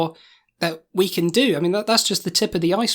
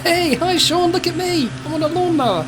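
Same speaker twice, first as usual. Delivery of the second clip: gleeful raised voice; shouting over the engine; 10 semitones higher than usual. Acted. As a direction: yell happily over the mower.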